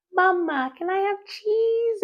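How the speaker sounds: put-on high little toddler voice, pleading; toddler asking. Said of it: "sometimes he wakes asking for".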